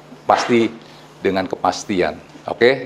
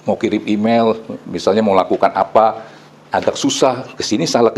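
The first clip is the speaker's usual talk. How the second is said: normal talking voice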